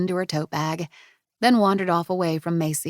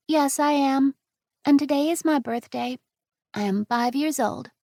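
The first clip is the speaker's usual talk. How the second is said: put-on high chatty five-year-old; a child explaining her adventure; little girl; 8 semitones higher than usual. Angela's voice.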